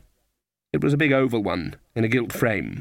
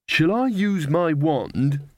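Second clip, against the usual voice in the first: slow, tentative offer, pitched higher; hesitant; 4 semitones higher, much slower than usual. A narrator's reading. Magrat offers her wand to Granny, hesitantly. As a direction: ask timidly, drawing the words out. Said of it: "said Magrat hesitantly".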